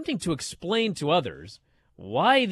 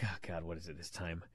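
weary dad groan; 11 semitones lower, 18 dB quieter, faster than usual. Retold or imitated. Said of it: "I'm like".

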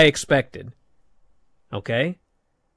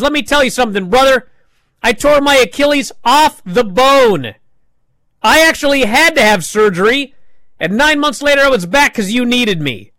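retold angry yell; 8 semitones higher, 5 dB louder than usual. Woods yells at Big E.

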